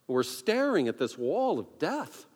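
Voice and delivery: ordinary talk, nothing marked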